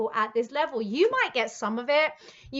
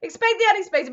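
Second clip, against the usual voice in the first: 1 mock dread; 8 semitones higher, 10 dB louder, faster than usual. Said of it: "but not".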